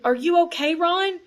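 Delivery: loud, high call. A narrator's reading. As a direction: call through the rocks. Calling through a rockfall.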